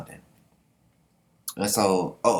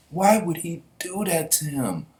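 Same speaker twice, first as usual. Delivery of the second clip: higher, slow mock voice of a friend; lightly put-on higher voice, half-amused; imitating her; 8 semitones higher than usual. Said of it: "she was like".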